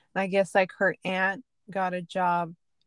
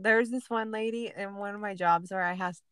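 ordinary delivery

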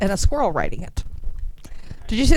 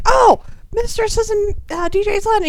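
high mock voice; 14 semitones higher than usual. Friend explaining where Mistress is, high imitating voice. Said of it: "And then he's like".

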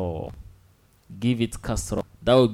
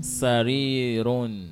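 slow teaching model; slower than usual. Shown as a demonstration. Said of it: "The word for bed in Arabic is".

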